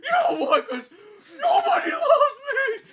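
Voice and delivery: very high, slow wailing; hammed-up sobbing confession, shouted out; mock tearful breakdown. Acts out driver's tearful breakdown.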